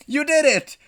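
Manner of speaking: high, loud cheer; triumphant congratulation. A narrator's reading. Game congratulates player for destroying Boston, triumphant. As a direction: celebrate the player's victory.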